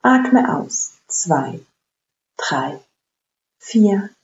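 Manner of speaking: slow, paced breath counting. Acted. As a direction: count each breath calmly and evenly. Counts the box breathing aloud.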